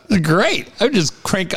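usual speaking voice

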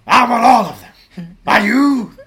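loud, slow Pacino bellow; shouting like Pacino; Al Pacino impression. Podcast hosts doing Pacino bits.

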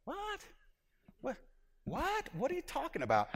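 high, soft, puzzled "what?"; baffled Nicodemus. Acted. Preacher voices Nicodemus not understanding Jesus, baffled. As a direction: softly squeak out a bewildered question. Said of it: "He's just saying".

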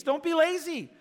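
preacher hammering the point; loud exhortation. Acted. Preacher urging his congregation, loud and high. Repeated exhortation, first punched.

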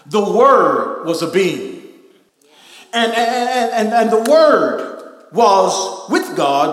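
loud, emphatic preacher with drawn-out words; full-force preaching. Real, not acted. Preacher telling congregation the Word was with God, full-force preaching. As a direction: drive each phrase home to the congregation.

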